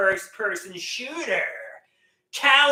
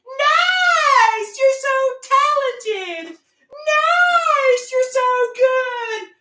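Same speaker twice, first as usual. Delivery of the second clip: much higher mock squeal of praise; high-pitched girly voice; 8 dB louder, slower than usual. Teammates praising him in a much higher mock girly squeal. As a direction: squeak it like giddy young teammates. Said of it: "in their high-pitched girly voice".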